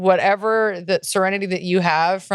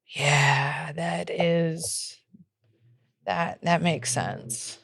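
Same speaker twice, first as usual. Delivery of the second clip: quiet awe; 2 semitones lower, 7 dB quieter, much slower than usual. Retold or imitated. Stunned by the no men advice.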